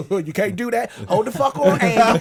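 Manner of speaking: mock low, goofy dude voice. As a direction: do a hint of a big goofy guy. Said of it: "the big goofy Caucasian man looking at me like".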